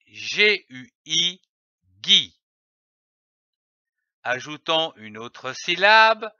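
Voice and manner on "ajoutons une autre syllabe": ordinary delivery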